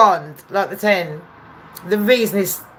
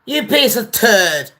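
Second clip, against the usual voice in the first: mock lawyer snapping; 3 semitones higher than usual. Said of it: "She's like".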